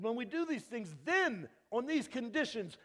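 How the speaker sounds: very loud, high, slow proclamation; preacher shouting God's promise; shouted preaching. Acted. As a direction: thunder out the promise. Preaching at full force.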